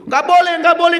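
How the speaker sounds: high, loud firm refusal; doctor flatly says no, high and loud; doctor refusing. Retold or imitated. Doctor refusing to release patient, high and loud.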